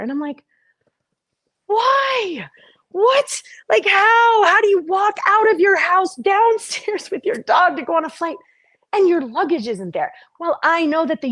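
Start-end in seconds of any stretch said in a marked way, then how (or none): 1.7-10.1 high-pitched, baffled disbelief; shocked disbelief. Retold or imitated. To herself at the car, finding no luggage, high-pitched panicked disbelief. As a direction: squeak out how could this happen.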